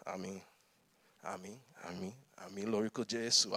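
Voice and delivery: soft, low murmured amens; dutiful amens. Retold or imitated. Boy murmuring amens as mum prays, soft and low. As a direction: mumble along dutifully. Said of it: "being a good Nigerian boy".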